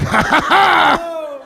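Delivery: slow, drawn-out high villain laugh; maniacal laugh. Pretend. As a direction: cackle as you land, to scare them. Landing on plane.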